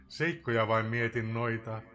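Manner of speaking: usual speaking voice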